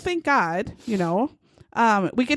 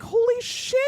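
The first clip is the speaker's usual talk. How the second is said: excited exclamation; 11 semitones higher than usual. Retold or imitated. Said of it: "the other guy was like".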